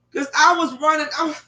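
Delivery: ordinary delivery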